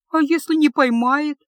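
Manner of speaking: trembling voice. A narrator's reading. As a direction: ask it with a shaking voice. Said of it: "дрожащим голосом спросила ученица мага". (дрожащим голосом, выше и громче)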